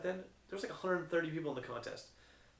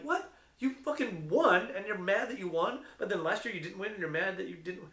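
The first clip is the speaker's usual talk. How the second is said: high, disbelieving retort; incredulous comeback; 5 semitones higher, 3 dB louder than usual. Retold or imitated. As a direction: throw it back at him, pitched up in disbelief. Retold baffled reply to winner's complaint.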